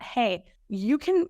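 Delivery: normal talking voice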